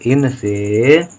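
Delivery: इन से stretched very slowly; teacher dictating इन से slowly to pupils; drawn out. Shown as a demonstration. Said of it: "एक ही मात्रा से".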